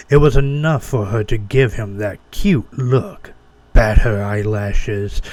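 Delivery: ordinary delivery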